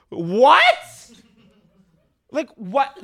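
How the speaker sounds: high, loud disbelief; stunned, can't believe it; incredulous. Real, not acted. Baffled by guest's bio.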